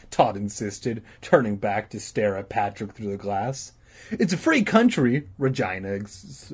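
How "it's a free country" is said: high, smug retort; reply smugly to tease him. Acted. Regina replying smugly to her upset brother, high and smug. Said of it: "replied smugly".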